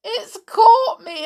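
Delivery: high sobbing wail; weeping. Acted. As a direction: cry out in tears. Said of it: "wept Amelia".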